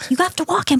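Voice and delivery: high-pitched mock scolding; exasperated insistence. Daughter orders parents to walk the dog, exasperated nagging. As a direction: nag them, pitched well up.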